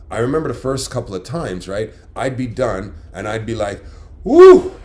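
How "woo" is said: loud high whoop of satisfaction; excited whoop. Retold or imitated. Husband at the table, done eating, whooping loudly. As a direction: let out a big whoop like the meal was great. Celebrating finishing his meal.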